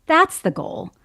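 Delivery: imitated dawning realization; a loud "oh" as it sinks in; sudden realization. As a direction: play the penny dropping. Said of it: "it hit her like a ton of bricks".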